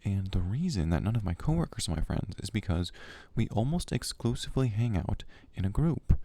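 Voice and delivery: ordinary delivery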